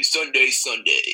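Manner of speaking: slow booming monster truck call. Shown as a demonstration. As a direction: stretch each Sunday, big and loud. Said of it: "could you give us another example".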